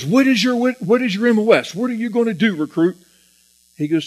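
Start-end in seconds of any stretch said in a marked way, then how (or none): 0.0-3.0 high, loud barking at a recruit; drill instructor yell. Retold or imitated. Captain demanding the recruit's job, high and loud. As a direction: grill him like a furious captain.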